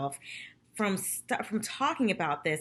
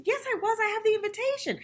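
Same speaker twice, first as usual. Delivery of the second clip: much higher, prim insisting voice; mocking Sonia's insistence; 14 semitones higher than usual. Recapper imitating Sonia's claim, high and prim. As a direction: play her smug and sure. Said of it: "And Sonia said".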